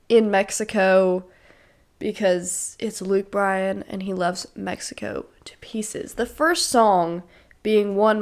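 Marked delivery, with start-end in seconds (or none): 2.0-6.1 whisper. Real, not acted. Playful whispered aside.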